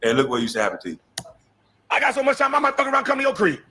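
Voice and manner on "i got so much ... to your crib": high, fast, sleazy come-on voice, mocking; mocking Gil. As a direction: put on a high, fast smooth-talker voice to mock him. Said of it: "this is how you, you used to talk to girls".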